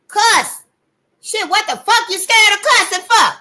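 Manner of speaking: loud, high-pitched goading outburst; exasperated shout. Real, not acted. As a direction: shout it like a dare. Loudly urges listeners to cuss.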